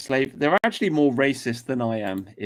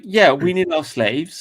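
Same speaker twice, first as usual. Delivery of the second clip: slow mocking sneer, raised voice; sardonic; 6 semitones higher, 5 dB louder, slower than usual. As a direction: drawl it out with contempt. Sarcastic mock of immigration view.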